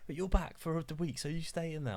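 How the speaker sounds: high, insistent mum voice; quoted mum. Retold or imitated. Mum tells returning son where he sleeps, high and insistent. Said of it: "She's like".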